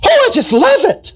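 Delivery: very high, squeaky "Oh, I just love it"; squealing mock gush. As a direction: squeal it like a silly gusher. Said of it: "Is that the way people talk?".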